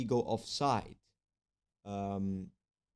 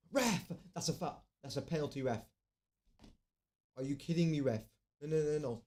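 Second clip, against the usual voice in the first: yelling at the tv; 5 semitones higher than usual. Real, not acted. Furious fan protests penalty call.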